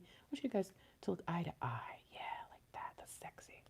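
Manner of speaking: hushed whisper, very soft. Real, not acted. Reader whispering to the cards to look eye to eye. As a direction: whisper it to yourself, barely audible. Murmurs to cards while arranging them.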